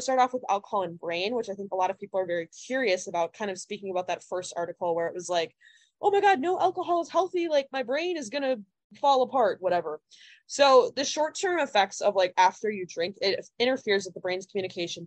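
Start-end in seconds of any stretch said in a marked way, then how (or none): 6.0-9.6 high-pitched mock alarm; mock panic. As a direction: exaggerate the horrified reaction, pitched up. Mock panicked reaction to health article.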